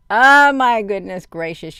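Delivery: loud, slow drawn-out oh my goodness gracious; shocked exclamation. Real, not acted. Reacting to the breach news for her viewers: loud astonished. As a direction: gasp it out in disbelief.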